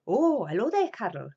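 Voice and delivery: imitated Welsh greeting, starting loud; Welsh-Nigerian accent. Terry greets the child at his door, Welsh-Nigerian accent. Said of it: "he was sort of Welsh and Nigerian".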